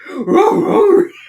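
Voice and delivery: loud, high imitated dog howl; hammed-up cartoon dog agreement; Scooby-Doo dog noise. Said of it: "Agrees Scooby-Doo".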